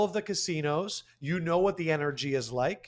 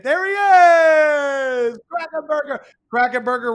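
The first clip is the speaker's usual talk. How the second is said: excited shout; 10 semitones higher, 10 dB louder than usual. Real, not acted. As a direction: hype the guest in. Excited welcome of guest.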